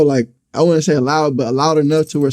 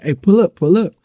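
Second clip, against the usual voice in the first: higher-pitched retold summons; pressing, raised phone plea; urgent call; 5 semitones higher, 3 dB louder than usual. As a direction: re-enact calling a friend for help.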